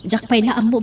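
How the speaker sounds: usual speaking voice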